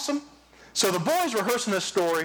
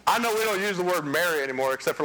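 ordinary talk, nothing marked

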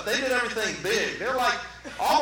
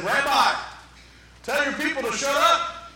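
higher, louder acted outrage; indignant demand; 6 semitones higher, 4 dB louder than usual. Preacher acts objectors scolding Jesus over the crowd, indignant. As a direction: demand that he silence them.

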